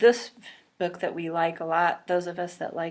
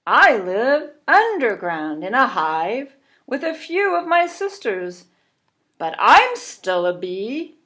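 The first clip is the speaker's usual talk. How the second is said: a raised, proud bumblebee; 6 dB louder than usual. Acted. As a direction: set the record straight, kindly. Bumblebee character speaks.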